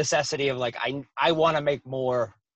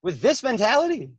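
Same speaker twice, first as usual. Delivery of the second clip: mock disbelief; 7 semitones higher than usual. Quoting himself to his parents.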